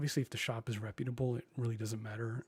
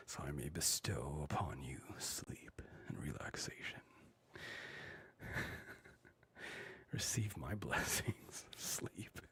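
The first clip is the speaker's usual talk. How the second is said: low whispered regal king voice; hammed-up royal whisper, much lower; Sleep King; 8 semitones lower, 7 dB quieter than usual. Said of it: "I am the Sleep King".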